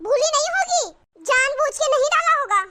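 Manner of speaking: mother-in-law scoffing at the bahu's excuse; sarcastic accusation. Acted. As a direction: jab at her with sarcasm.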